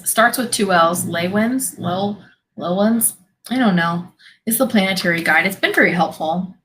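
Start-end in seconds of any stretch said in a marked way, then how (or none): 1.0-3.1 slow, halting guess at Lewins with a questioning rise; sounding out Lewins syllable by syllable; unsure pronunciation. Real, not acted. Reading a strange name aloud for viewers, sounding it out. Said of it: "how am I supposed to pronounce this?".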